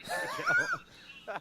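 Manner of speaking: high stretched laugh; laughing through the words slowly. Real, not acted. Laughing at robot sound.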